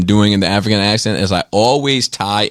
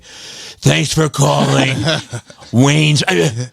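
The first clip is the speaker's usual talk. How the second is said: slow, lightly hammed Batman impression; 5 semitones higher, much slower than usual. Answering work phones as Batman, lightly voiced Batman. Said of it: "I did the Batman voice".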